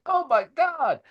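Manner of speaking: way up high, mock dismay; aghast. Narrator behind L V in the newspaper photo, high mock dismay. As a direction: squeal it like you can't believe the mess. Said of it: "kinda, like, going".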